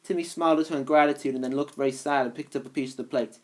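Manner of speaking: ordinary delivery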